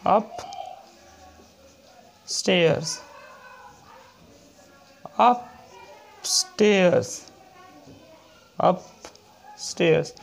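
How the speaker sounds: very slow, drawn-out word; teacher dictating an example; slow dictation. Shown as a demonstration. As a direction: stretch the word for the class. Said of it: "next है".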